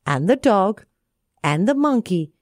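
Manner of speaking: usual speaking voice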